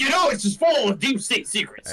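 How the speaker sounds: high, shouted conspiracy rant; Alex Jones. Pretend. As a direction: shout it like a mock alarmist host. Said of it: "start doing Alex Jones stuff".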